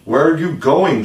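high, loud worried question; crying out with misgiving. A narrator's reading. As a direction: call out the question with a touch of alarm. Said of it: "she cried with misgiving".

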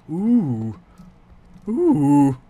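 loud high amazed ooh. Real, not acted. Gamer awed by lava, loud high ooh. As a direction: gasp out a wondering ooh.

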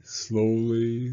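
plain reading voice

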